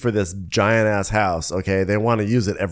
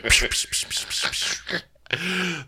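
pistol shot noises; 10 semitones higher, 4 dB quieter, slower than usual. Retold or imitated. Imitating pistol shots.

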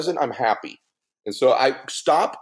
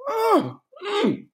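high imitated moan, much higher than his voice; mock pleasure moan. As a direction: squeal it like the food is heavenly. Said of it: "she'll do it in restaurants".

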